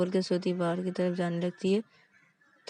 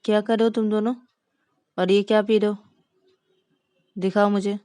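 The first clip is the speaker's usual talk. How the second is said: stern questioning; 3 semitones higher, 7 dB louder, faster than usual. Acted. Wife catches them drinking.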